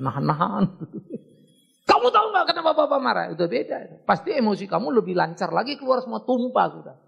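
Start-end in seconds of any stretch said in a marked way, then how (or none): 1.9-3.3 higher, louder and fast, as a scolding father; angry raised version. Shown as a demonstration. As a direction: let the anger spill out loud and quick. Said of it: "suara bisa keras".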